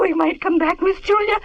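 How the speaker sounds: usual speaking voice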